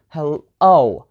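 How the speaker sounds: hello with the final O held long and strong; stress on O. Shown as a demonstration. Teacher showing learners the stressed vowel, stress on the O in hello. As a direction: lean on the O in hello. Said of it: "put the stress on the letter O".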